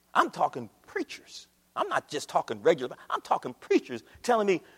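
usual speaking voice

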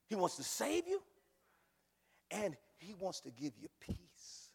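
ordinary delivery

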